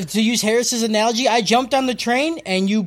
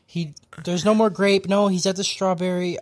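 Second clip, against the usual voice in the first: ordinary talk, nothing marked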